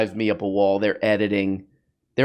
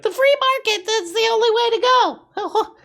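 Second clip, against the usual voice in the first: very high squeaky cartoon voice, mocking; squeaky parody voice.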